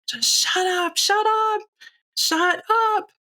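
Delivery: high-pitched mock scream, no real volume; retold screaming. Mother reenacting her blowup at her daughter, restrained high scream. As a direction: imitate a screamed outburst, drawn high but held back. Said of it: "I was just screaming".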